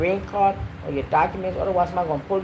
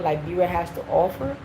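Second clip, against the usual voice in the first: normal talking voice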